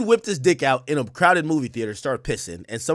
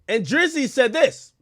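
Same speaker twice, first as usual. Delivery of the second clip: normal talking voice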